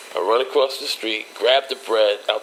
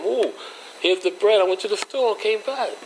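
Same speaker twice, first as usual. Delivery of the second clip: put-on much higher pitched boy voice; kid voice; 11 semitones higher than usual. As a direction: brush her off like a kid. Child self pushing past mom.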